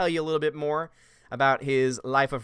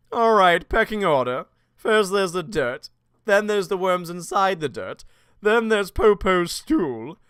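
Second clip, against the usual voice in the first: louder, higher cartoon character voice; Mister Popo; 4 semitones higher, 6 dB louder, slower than usual. Character explains the pecking order, hammed-up parody voice. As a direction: lay down the ranking, slow and emphatic. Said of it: "Mister Popo was probably my favorite".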